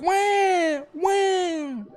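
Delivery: very high, loud mock newborn cry; imitated baby wail. Scene: baby Mark sobbing in hospital, mock high wail. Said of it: "He's freaking sobbing".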